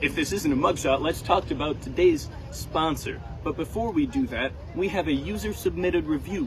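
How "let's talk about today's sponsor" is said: usual speaking voice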